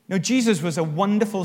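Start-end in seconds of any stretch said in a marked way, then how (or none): none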